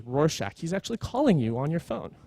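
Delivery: ordinary talk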